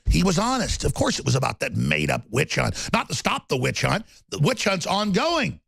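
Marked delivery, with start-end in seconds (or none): none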